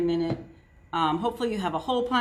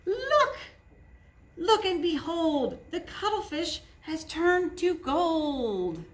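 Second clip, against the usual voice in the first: higher, slow showman unveiling; grand reveal; 7 semitones higher, slower than usual. A narrator's reading. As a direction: present the wonder proudly. Magician's reveal.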